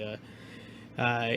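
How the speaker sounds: usual speaking voice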